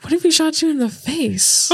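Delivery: mock question. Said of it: "He's like".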